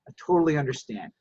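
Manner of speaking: low, soft, calm reply; calm understanding. Shown as a demonstration. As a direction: defuse the anger gently. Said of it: "what's the magic word".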